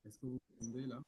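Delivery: faint and distant, off mic; asking from far away from the mic. Real, not acted. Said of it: "Euh, on t'entend de loin".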